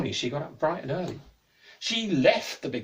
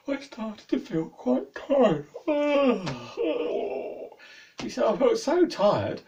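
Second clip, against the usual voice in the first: sleepy; 7 semitones higher, 4 dB louder, slower than usual. Acted. Man growing drowsy.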